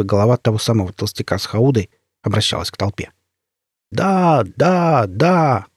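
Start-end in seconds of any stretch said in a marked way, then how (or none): 3.9-5.7 mild mock crowd yell, higher pitch; drawn-up, higher-pitched cheer of agreement, understated; crowd's cry of assent. A narrator's reading. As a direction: voice a crowd's eager yes, without real shouting. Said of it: "Яростный крик согласия".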